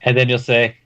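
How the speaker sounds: ordinary talk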